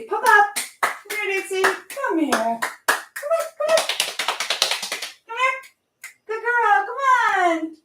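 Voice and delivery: high-pitched coaxing dog call. Real, not acted. Owner calling Dixie the dog over, high-pitched coaxing. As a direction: coax the pup over in a high sing-out.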